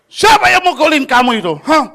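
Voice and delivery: loud, high furious questioning; angry demand. Retold or imitated. Teacher angrily demands who beat his pupil.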